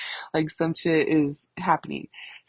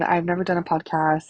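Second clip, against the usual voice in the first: usual speaking voice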